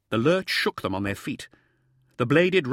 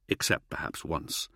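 plain reading voice

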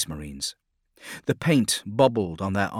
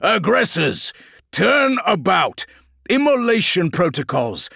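high, loud commander's orders; forceful, drawn-out battlefield commands; shouting to be heard; 9 semitones higher, 5 dB louder than usual. Acted. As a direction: bellow orders over the battle. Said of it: "Felix had to shout to make himself heard".

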